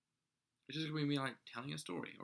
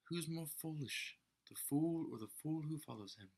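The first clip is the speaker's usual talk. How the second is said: Obi-Wan; 5 semitones higher than usual. Acted. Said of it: "By Obi-Wan, of course".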